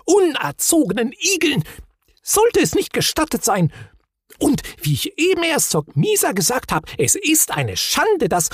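high, indignant fussing; flustered lady voice, very high; Gafsa's shriek. A narrator's reading. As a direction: scold at a high pitch, all aflutter. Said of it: "schrie die Gafsa".

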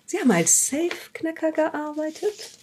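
startled, rising "Safe-Knacker?"; surprised question. Acted. Detective finds safecracker record.

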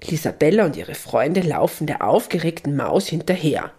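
ordinary delivery

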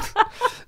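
high giggling burst; real amused laugh; high burst of laughter. Real, not acted. Laughs at own story.

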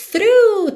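"Through" called out high and loud, tongue between teeth; slow demo word. Shown as a demonstration. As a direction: announce "Through" clearly as the model word. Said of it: "T H R O U G H". ("Through" alto e agudo, língua nos dentes)

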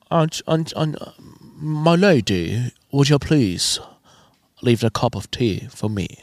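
lightly voiced slow posh-English noble; a hint of an old aristocrat asking for tea; seventeenth-century nobleman. Host mocking his nasal voice as a 1640 nobleman, lightly voiced. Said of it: "Ich kling wie so ein Adliger aus sechzehnhundertvierzig".

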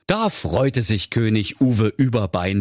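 plain reading voice

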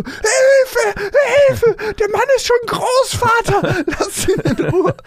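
hammed-up, very high panicked cry for help; panicked scream. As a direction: mimic a frantic shriek to save someone. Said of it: "so ganz panisch geschrien".

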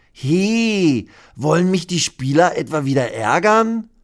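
hohe Dinostimme, leicht argwöhnisch; misstrauischer Dino. Acted. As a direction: frag dich hoch und halb beleidigt. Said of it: "fragt sich Hermann". (hohe, misstrauische Dinostimme)

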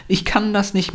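put-on high-pitched protesting kid; klagendes Kind. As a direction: complain like a child giving up. Child complains, parent answers. (hoch gesprochenes, klagendes Kind)

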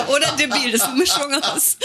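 words pushed out through laughter, pitched high; laughing. Real, not acted. Co-host jokes about Granufink, laughing through the words. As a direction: still giggling while making the joke. Joking about the medicine names.

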